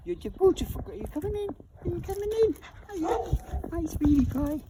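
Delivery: very high cooing pet talk. Real, not acted. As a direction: coo sweetly at a little animal. Cooing at the pets.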